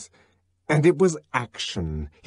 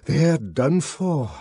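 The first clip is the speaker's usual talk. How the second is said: dismayed; 4 semitones higher, slower than usual. A narrator's reading. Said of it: "said Bernard".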